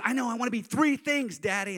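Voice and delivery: put-on high-pitched eager child; five-year-old daughter. Daughter listing ambitions to dad, high childish voice. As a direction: imitate a little kid talking to daddy. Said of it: "And she goes".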